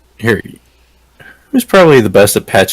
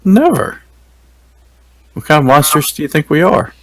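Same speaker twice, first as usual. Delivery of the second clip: mock offended; 6 semitones higher than usual. Player mocking captive.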